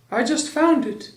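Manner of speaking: high-pitched little bear; Pooh's voice. A narrator's reading. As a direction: answer simply in a high bear voice. Voicing Pooh in the story.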